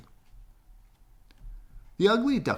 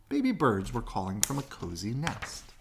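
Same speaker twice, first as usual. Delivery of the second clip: plain reading voice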